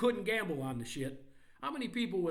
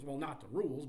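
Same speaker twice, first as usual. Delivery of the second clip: normal talking voice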